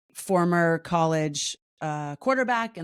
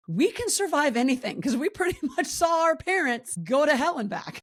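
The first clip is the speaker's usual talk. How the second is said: amused imitation of the kids; 9 semitones higher than usual. Next generation voiced, laughing.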